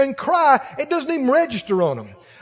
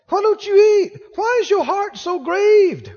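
higher, coaxing husband voice; pleading husband; 8 semitones higher than usual. Acted. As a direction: gently beg her to eat. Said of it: "And he said".

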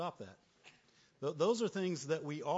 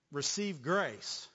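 ordinary talk, nothing marked

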